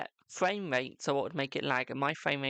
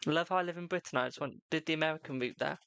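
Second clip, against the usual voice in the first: usual speaking voice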